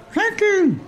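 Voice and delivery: very high squeaky voice; a tiny robot's thanks; high-pitched hologram thanks; Bob's voice. Acted. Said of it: "it's Bob's voice".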